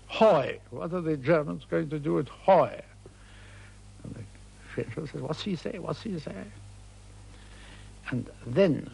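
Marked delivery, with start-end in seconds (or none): 0.1-2.8 triumphant. Retold or imitated. British general finally managing a French place name, triumphantly. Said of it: "he said triumphantly". 5.2-6.4 higher, softer quick aside; baffled listener. Retold or imitated. Puzzled French general asking aside what was said, baffled.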